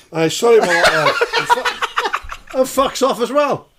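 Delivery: high, loud, breaking into laughter; laughing hard. Real, not acted. Comedian retelling the Scottish guy's exit, laughing helplessly. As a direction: barely get the words out for laughing.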